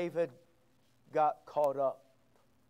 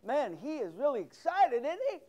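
mocking bystander; 7 semitones higher, 3 dB louder than usual. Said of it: "everybody'd stand around and go".

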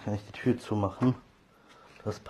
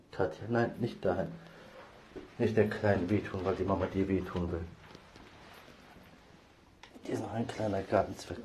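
ordinary delivery

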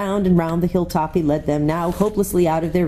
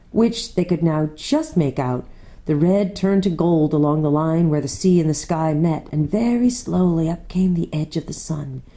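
ordinary delivery

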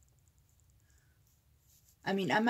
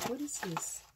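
ordinary talk

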